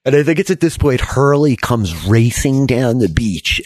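normal talking voice

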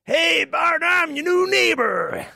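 high, loud, slow hammed-up cowboy drawl. Cowboy greets the new neighbour, hammed-up high drawl. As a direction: play the friendly cowboy neighbour big. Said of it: "There's a cowboy there".

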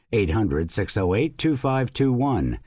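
normal talking voice

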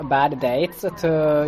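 ordinary delivery

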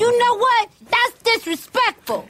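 sassy, slow mock offence; mock indignant. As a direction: play up the offence, drawing it out. Playing up mock outrage.

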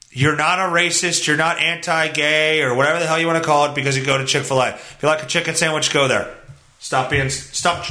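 ordinary talk, nothing marked

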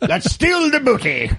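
drawn-out pirate scheming. Retold or imitated. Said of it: "So I was thinking pirates".

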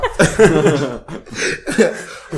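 laughing out loud at himself; loud real laugh. Real, not acted. Laughing at his own story.

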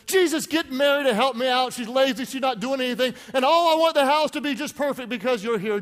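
a flustered hostess whining for help; frantic Martha. Retold or imitated. Martha begging Jesus to make Mary help, frantic. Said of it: "she's freaking out, saying".

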